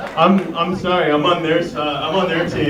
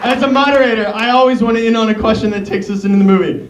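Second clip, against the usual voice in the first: breathless laughing; 6 semitones higher, 3 dB louder than usual. Real, not acted. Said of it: "I'm also out of breath".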